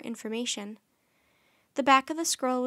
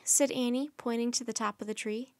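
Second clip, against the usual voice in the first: ordinary delivery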